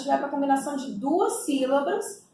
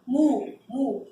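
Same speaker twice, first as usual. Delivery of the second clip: normal talking voice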